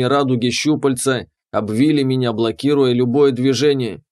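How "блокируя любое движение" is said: plain reading voice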